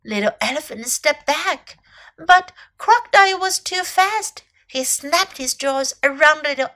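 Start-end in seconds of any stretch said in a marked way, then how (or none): none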